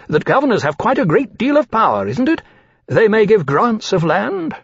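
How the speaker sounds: put-on high, eager, ingratiating young dragon voice; fawning dragon Caesar. Young dragon Caesar flattering the captain, in a high ingratiating voice.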